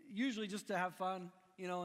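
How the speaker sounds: ordinary talk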